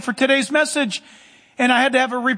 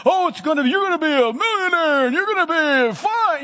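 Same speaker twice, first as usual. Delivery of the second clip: mock prosperity preacher; 6 semitones higher than usual. Mocking preachers who just speak things, hammed-up proclamation. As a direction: parody a showy preacher promising blessings. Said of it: "He doesn't talk like that".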